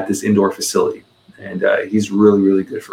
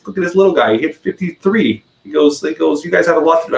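joking tease; 8 semitones higher than usual. Retold or imitated. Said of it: "I just said the jokingly".

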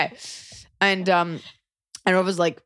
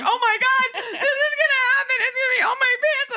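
high, laughing panic near a shout; pitched-up panic, half laughing, half crying; panicked. Retold or imitated. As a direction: squeal it out in laughing terror. Panic of imminent accident.

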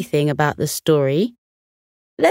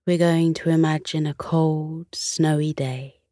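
guided meditation; 3 dB quieter than usual. Acted. Guided imagery.

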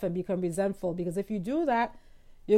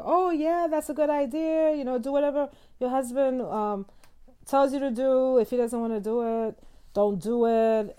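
mocking commenters; 4 semitones higher, 3 dB louder than usual. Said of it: "some people on the page, of course, were like".

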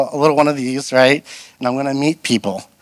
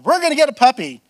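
excited announcement; 11 semitones higher, 4 dB louder, faster than usual. Retold or imitated. Owner announcing new puppy.

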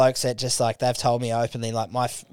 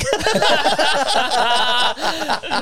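high, long burst of laughter; big laugh; 13 semitones higher, 4 dB louder than usual. Real, not acted. The room cracks up at the welding line, big laugh. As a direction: crack up helplessly at a punchline.

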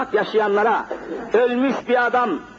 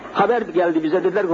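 ordinary talk

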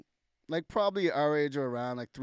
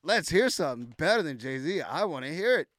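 loud, higher-pitched dare; hyped-up call for a show; eager challenge; 4 semitones higher, 7 dB louder than usual. Retold or imitated. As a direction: egg him on to prove it. Challenging the boaster to prove it.

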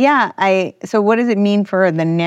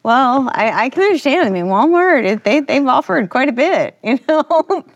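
mock earnest; 9 semitones higher than usual. Joking defence of Walmart.

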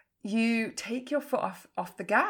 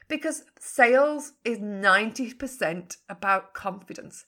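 normal talking voice

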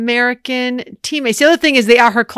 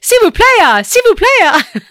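S'il vous plaît said loud and high with a hammed-up Italian lilt; Italian accent French; 10 semitones higher, 5 dB louder, slower than usual. American tourist speaking French in a mock Italian accent. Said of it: "French with an Italian accent".

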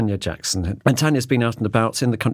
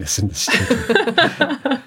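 high, slow, laughing finish; much slower than usual. Real, not acted. Host welcoming guest away from the chicken, laughing. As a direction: dissolve into laughter on the last words.

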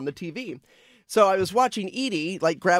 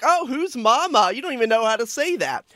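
put-on taunting wife about baby's words; gloating; 7 semitones higher than usual. Gloating dad teasing about who baby loves, smug. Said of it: "I was soaking it in like".

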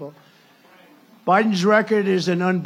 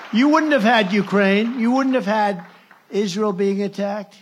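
emphatic rally shout; 4 semitones higher, 6 dB louder than usual. Real, not acted. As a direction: hammer it home to the crowd. Rally speech, loud emphatic.

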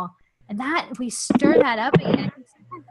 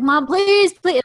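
put-on high, loud childish plea; mock begging child; 7 semitones higher, 5 dB louder, slower than usual. Daughter begging her mom at the beach, high and loud. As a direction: beg like a seven-year-old wanting flip-flops. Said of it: "kind of begging".

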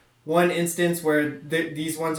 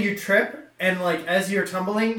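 ordinary delivery

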